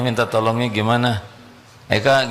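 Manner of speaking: ordinary delivery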